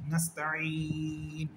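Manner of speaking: নাস্তাঈন with ঈ held for three alif, slow, ending on a silent ন; three-alif stretch. Shown as a demonstration. Teacher showing students the pausal lengthening. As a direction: stretch ঈ long in নাস্তাঈন, then stop. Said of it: "তিন আলিফ টানবো".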